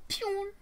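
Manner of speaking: very high-pitched "pew"; launch sound effect. Acted. Comic telling of a rover slung into space, high imitated noise. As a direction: imitate something flung away with a high zing.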